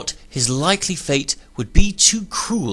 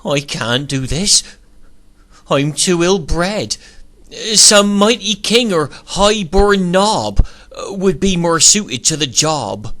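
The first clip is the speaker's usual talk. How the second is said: trembling; 6 semitones higher, 4 dB louder than usual. A narrator's reading. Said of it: "in a trembling voice he said".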